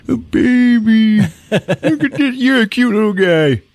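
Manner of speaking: very high cutesy voice; cooing baby talk. Retold or imitated. Rescuer picking up the baby, high cooing. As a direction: coo at a baby in a squeaky voice.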